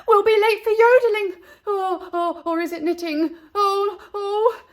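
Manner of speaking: high, loud, breathless fluster; panting nanny. Acted. Nanny chasing Ernestine to the park, panting. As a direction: gasp it out between breaths. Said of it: "panted Nanny".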